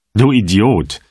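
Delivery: loud, furious insult; angry. A narrator's reading. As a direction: snap the insult out hard. Kettler furious at gatekeeper.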